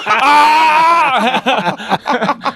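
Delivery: high loud pained shout. Retold or imitated. Retelling phone call chaos, a high pained shout. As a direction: yell out in pain and disbelief. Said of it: "I'm like".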